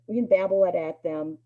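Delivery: usual speaking voice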